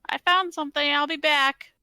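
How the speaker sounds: calling out. Acted. Adventurer tells companions they'll be back, calling out. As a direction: shout it back down the tunnel.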